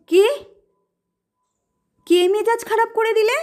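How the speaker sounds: high, startled question. Acted. Brother asks startled, high-pitched. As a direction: jump at the news. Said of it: "চমকে বলে".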